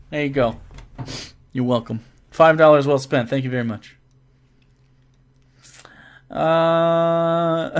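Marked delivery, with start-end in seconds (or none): none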